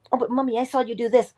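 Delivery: put-on child voice. Child catching parent in story.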